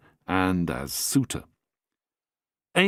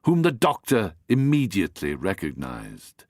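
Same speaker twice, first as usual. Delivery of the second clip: ordinary delivery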